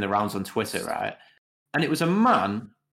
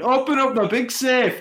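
high, loud mock Scottish shout; imitating the Scottish guy; 5 dB louder than usual. Half-drunk mates in the bookies, mimicking the clip in a loud high Scottish yell. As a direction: shout it like the man from the video. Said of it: "just like our guy from the video".

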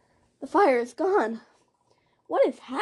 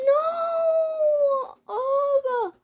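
high anguished cry; 13 semitones higher, 4 dB louder, slower than usual. Real, not acted. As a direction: wail in dismay. Teammate just killed.

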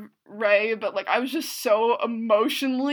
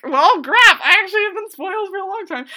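laughing; 9 semitones higher, 9 dB louder, faster than usual. Retold or imitated. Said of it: "I was like".

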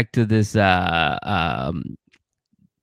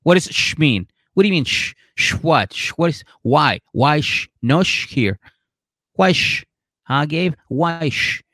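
higher, rapid-fire demanding questions; indignant girlfriend; 5 semitones higher than usual. Retold or imitated. Imitating the girlfriend grilling her man about the shushing. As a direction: play her offended, grilling him. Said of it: "And Isabella's like".

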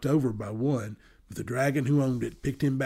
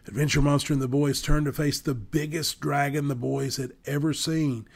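usual speaking voice

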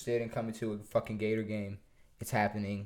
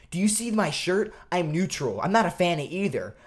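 indignant retort; 5 semitones higher, 5 dB louder than usual. Retold or imitated. Acts out fan's indignant retort.

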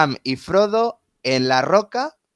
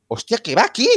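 higher-pitched, startled reaction; retold astonishment; 7 semitones higher than usual. Fan recalls hearing the soundtrack in the film, astonished. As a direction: act out being caught off guard.

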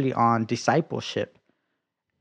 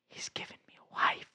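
awed whisper; 12 dB quieter than usual. Retold or imitated. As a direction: breath-only whisper of wonder. Awed realization after long pause.

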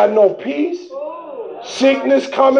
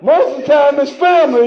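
normal talking voice

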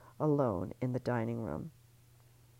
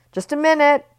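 high, loud call through the door; 13 semitones higher, 18 dB louder than usual. Acted. Ellie calling through the bathroom door, high and loud. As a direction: call out quickly to cover. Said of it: "Ellie called".

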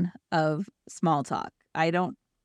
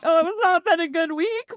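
hammed-up weepy complaint; mock crying; 9 semitones higher than usual. Retelling breaking down to her friend Yessie, mock crying. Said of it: "start crying and like".

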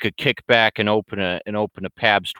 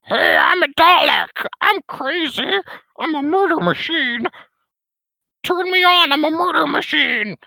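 very high, squeaky robot voice, a bit louder; a silly Dalek boasting about murder; Dalek impression; 3 dB louder than usual. Showing chat his Dalek voice, very high and squeaky. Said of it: "I can do a good".

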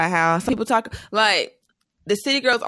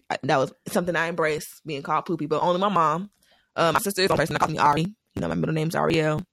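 usual speaking voice

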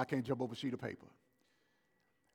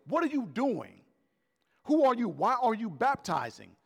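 demanding Pharisees; 7 semitones higher, 5 dB louder than usual. Retold or imitated. Voicing Pharisees interrogating John.

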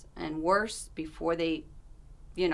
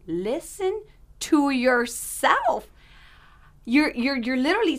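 stern wake-up call; 7 semitones higher, 7 dB louder, slower than usual. Retold or imitated. Coworker's alarmed rebuke.

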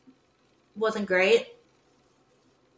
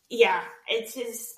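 ordinary delivery

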